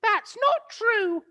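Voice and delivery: put-on high, loud old-woman cry; crying out in alarm. Missus Twit frightened, crying out to her husband. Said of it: "cried Missus Twit".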